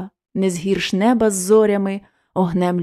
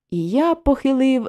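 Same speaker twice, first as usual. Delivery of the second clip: ordinary delivery, nothing marked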